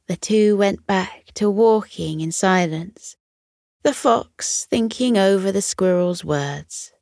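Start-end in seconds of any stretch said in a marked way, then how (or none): none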